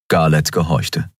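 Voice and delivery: plain reading voice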